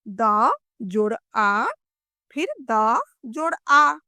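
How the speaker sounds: द, जोड़, आ as separate sounds with pauses; sound-by-sound spelling. Shown as a demonstration. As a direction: isolate द, then a long, rising आ. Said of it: "इस तरह से समझेंगे". (द और आ अलग-अलग, साफ़ बोलना)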